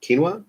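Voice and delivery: "Quinoa" as KEEN-wah, rising like a question; self-corrected pronunciation. Real, not acted. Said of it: "I know how to pronounce that".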